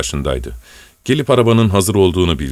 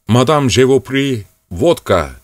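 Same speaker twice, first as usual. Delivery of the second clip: mock French; 5 semitones higher, 3 dB louder than usual. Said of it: "deyip dururdu".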